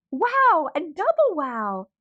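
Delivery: loud, high burst of delight; thrilled wow. A narrator's reading. Girl overjoyed at the piñata prizes, loud and high. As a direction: shout it out with pure glee.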